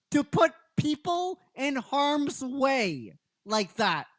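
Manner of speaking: Kimberly crying out at the heavens, high and pleading; imitating Kimberly crying. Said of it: "she's crying".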